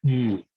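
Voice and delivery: "mm" sustained as a lone nasal NG consonant; NG sound demo. Shown as a demonstration. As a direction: hum "mm" like the end of an English NG word. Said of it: "pronounced like the English N G".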